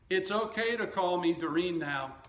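usual speaking voice

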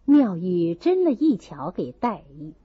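plain reading voice

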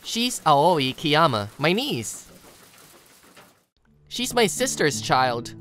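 ordinary delivery

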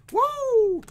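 high, loud whoop of joy; excited whoop. Real, not acted. Thrilled whoop at a good card pull. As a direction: let out a big cheer for the pull. Hype cheer over a card.

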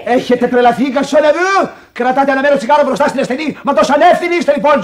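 loud, high, furious scolding; outraged shout. Acted. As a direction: blast them for their carelessness. Outraged at smoking near patient. (θυμωμένη, δυνατή φωνή)

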